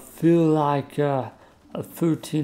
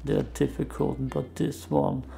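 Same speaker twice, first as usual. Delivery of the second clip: creaky, quiet fry talk; vocal fry; 3 semitones higher, 3 dB quieter than usual. Shown as a demonstration. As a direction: keep talking in vocal fry. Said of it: "I could just talk like that for hours".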